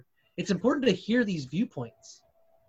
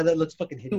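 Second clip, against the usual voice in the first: ordinary delivery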